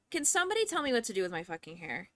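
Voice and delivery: ordinary talk, nothing marked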